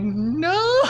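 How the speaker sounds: a high, laughing shout of "No!"; Harley's shout. Acted. Harley yells over her dead hyenas, a laughing shout. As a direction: wail it out, laughing through it.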